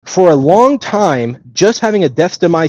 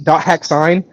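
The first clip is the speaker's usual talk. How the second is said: ordinary delivery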